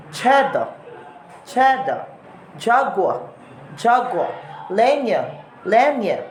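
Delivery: "cheddar", "jaguar", "lenya" ending in short A; Australian word endings, R dropped for an A. Shown as a demonstration. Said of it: "with a short A sound".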